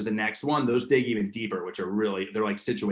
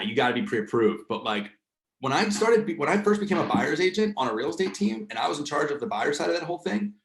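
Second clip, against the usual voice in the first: ordinary talk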